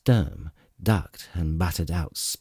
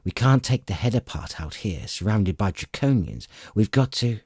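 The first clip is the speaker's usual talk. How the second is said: plain reading voice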